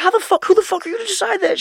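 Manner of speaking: high, loud, indignant shout; mock furious yell. Son mock-raging at mom over Nutella. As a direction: rage at her in mock disbelief. Retelling cursing out his mom.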